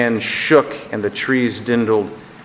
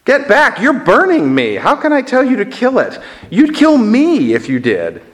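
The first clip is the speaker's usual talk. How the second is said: high-pitched cry of alarm; pained protest; 11 semitones higher, 3 dB louder than usual. Acted. Ghost to the angel burning him, high pained protest.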